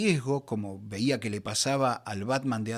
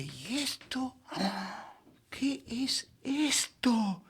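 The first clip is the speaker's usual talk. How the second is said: much higher, softer, very slow astonished question; amazed wonder; 10 semitones higher, 3 dB quieter, much slower than usual. Retold or imitated. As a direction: breathe it out as if you cannot believe what you're reading. First discovering a forbidden book.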